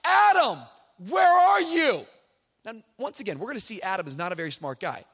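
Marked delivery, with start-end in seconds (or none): 0.0-2.1 loud, high booming call; God shouting. Retold or imitated. God calling out for hiding Adam, loud shout. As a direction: shout across the garden for him. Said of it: "he shouts out".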